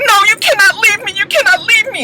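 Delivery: high mock desperate pleading; pleading wife. Recapper voicing the wife begging her husband to stay, high. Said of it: "she's just like".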